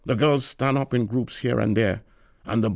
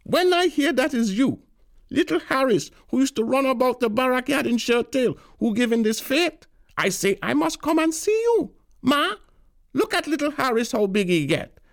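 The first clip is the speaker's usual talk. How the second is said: put-on gushing old aunt. A narrator's reading. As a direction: gush over him like a proud auntie. Tanti gushing at Harris.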